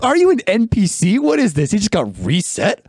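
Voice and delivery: high, squeaky mock astonishment; joking to a friend that the man reset, high astonished squeal; amazed disbelief. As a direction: marvel at it like it's a glitch in a game.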